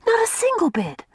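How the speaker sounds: eager and quick, high pitched. A narrator's reading. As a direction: eagerly insist it's not true. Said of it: "said Una eagerly".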